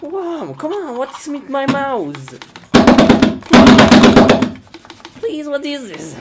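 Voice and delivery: high, whining protest; exasperated pleading. Acted. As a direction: plead in a high, frustrated whine. Pleading with broken mouse.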